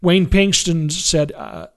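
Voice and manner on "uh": ordinary talk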